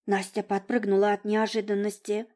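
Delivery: usual speaking voice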